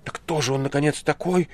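high, loud, excited demand; agitated. A narrator's reading. Ivan asks about the stranger, agitated. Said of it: "в возбуждении, потрясая кулаками".

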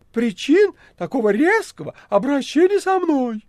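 high, indignant cat voice; offended cat. Acted. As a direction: act hurt and innocent. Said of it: "говорил он сверху".

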